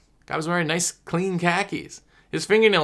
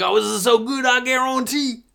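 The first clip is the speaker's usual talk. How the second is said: higher, hammed-up Cajun chef voice; Cajun chef impression; 8 semitones higher than usual. A sales rep showing buyers the Cajun chef code phrase. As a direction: ham up the Cajun cook's "I guarantee". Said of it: "in that Bayou voice".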